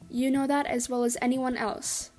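plain reading voice

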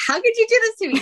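high-pitched wail at a husband, breaking into laughter; mock-angry wail. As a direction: retell the outrage as a squeaky, comic protest. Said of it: "I was angry at my husband".

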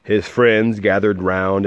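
plain reading voice

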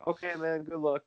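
soft, easy-going good luck; imitating calm fighter. Enforcer calmly accepting a fight, soft and relaxed. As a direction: shrug it off like nothing's at stake. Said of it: "Like, so calm".

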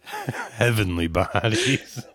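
very slow, drawn-out ad tagline; hammed-up slow strip club ad read; mock commercial voiceover. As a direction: stretch the club name like a cheesy radio spot. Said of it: "the worst commercial ever".

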